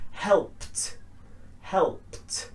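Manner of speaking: "helped" ending in a voiceless t. Shown as a demonstration. Teacher correcting a common mistake, crisp T ending. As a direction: say "helped" as one syllable, "helpt". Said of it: "with a t".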